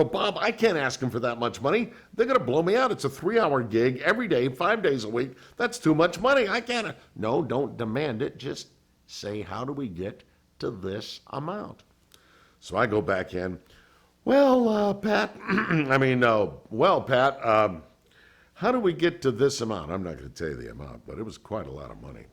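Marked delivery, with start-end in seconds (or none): 0.0-7.0 much higher, louder mock panic; hammed-up alarm at asking for too much; panicked. Panicking to his attorney. 7.1-11.8 put-on a light Tennessee accent; imitating the Tennessee attorney's calm advice; Tennessee drawl. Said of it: "talks like this". 14.2-19.9 much higher, louder, halting mock nerves; nervous. Nervously asking for the money.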